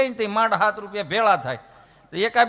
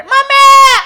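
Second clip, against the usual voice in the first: much higher, very loud wail for mummy; child's scream; 14 dB louder, much slower than usual. Retold or imitated. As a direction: scream for mummy like a fallen kid. Fallen child screaming for mom.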